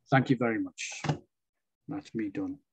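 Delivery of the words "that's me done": ordinary talk, nothing marked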